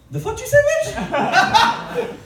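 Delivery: slow, high, loud shrieked outrage; outraged shriek. Retold or imitated. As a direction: stretch out a shocked high yell. Reaction to sister's name Fiona.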